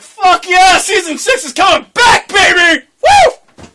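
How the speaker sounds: high, loud hype yell; excited shout. Retold or imitated. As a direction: cheer like great news. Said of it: "I was like".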